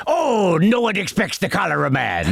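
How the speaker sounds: a light Welsh pirate accent, hammed up; a hint of a Welsh pirate accent, theatrical. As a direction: play the Cholera Man as a mock Welsh pirate. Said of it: "this kind of Welsh pirate voice".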